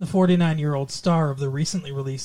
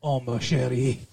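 low cartoon French accent; Pepe Le Pew; 5 semitones lower, 3 dB quieter than usual. Shown as a demonstration. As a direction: croon it in a hammed-up French accent. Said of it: "I can also do French".

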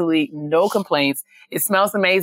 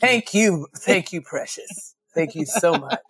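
mock Talley thanks.

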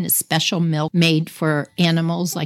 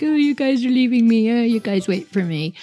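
higher, mock-whining little kid left behind; whiny crybaby; 6 semitones higher than usual. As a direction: play the tearful little sister. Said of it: "I was such a crybaby".

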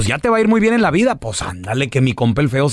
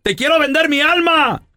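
high, pleading whine; el feo; 4 semitones higher than usual. Acted. Poor man bargaining with the devil, high pleading. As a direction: plead your hard-luck case in a high voice. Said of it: "grita el feo".

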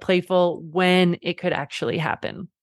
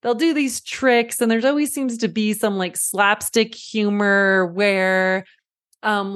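ordinary talk

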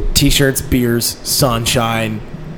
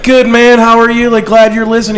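radio host; 10 semitones higher, 5 dB louder than usual. Retold or imitated. Radio host answering caller.

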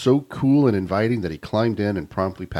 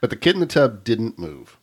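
usual speaking voice

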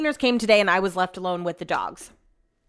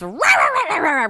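rapid high mock barking; frantic little-dog snarling noise; imitated dog yapping; 6 semitones higher, 3 dB louder than usual. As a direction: yap like a little dog going for ankles. Imitates small dog attacking ankles.

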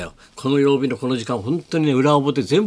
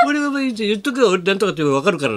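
imitating tipsy local; 6 semitones higher than usual. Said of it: "なんつってさ".

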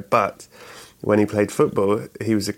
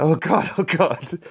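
mock horrified; 7 semitones higher than usual. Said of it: "the kid was so shocked and horrified".

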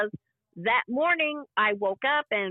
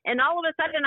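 ordinary talk

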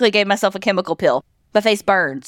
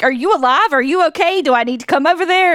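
higher-pitched urgent worried questions; retold alarm; 7 semitones higher than usual. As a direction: check on a friend in mock panic. Retold worried check on sleeping friend.

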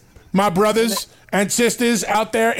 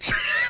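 wheezing laugh; 7 dB quieter than usual. Real, not acted. After a vodka shot.

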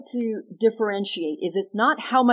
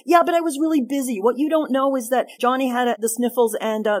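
higher, louder, rushed excuses; defensive excuse; 5 semitones higher, 5 dB louder than usual. Shown as a demonstration. Wife dodging her husband's complaint, defensively whining. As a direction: deflect the blame, whiny and defensive. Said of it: "And I go".

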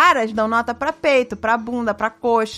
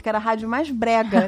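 usual speaking voice

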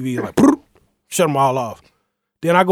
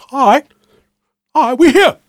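higher, louder hyped cheer; hyped celebration; 11 semitones higher, 7 dB louder than usual. Retold or imitated. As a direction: cheer like your team just scored. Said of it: "And I'm feeling like".

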